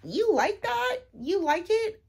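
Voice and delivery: high mock accusation from readers who went in for plot. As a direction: mimic a smug reader catching you out. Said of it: "come back at me being like".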